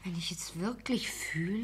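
wife whispering to herself. Acted. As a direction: whisper it so the men barely hear. Wife murmurs aside during argument.

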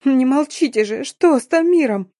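high-pitched anguished plea; acted desperate wail for an answer. A narrator's reading. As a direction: wail the question, pitch climbing. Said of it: "завыла".

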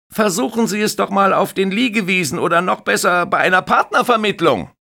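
high, loud teasing lifeguard voice; jokey young lifeguard brushing him off; cheeky lifeguard. Acted. (frecher junger Bademeister, laut und hoch)

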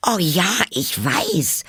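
Alice calling out, high and drawn out; exclaimed. Acted. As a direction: burst out eagerly. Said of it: "rief Alice aus".

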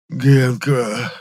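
протяжный страдальческий зов, повыше и погромче; позови, будто умираешь; хрип умирающего лебедя. A narrator's reading. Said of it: "прохрипел Моня голосом умирающего лебедя". (театральный предсмертный хрип)

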